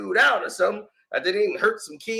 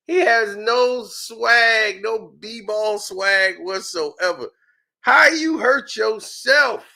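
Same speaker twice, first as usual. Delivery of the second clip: normal talking voice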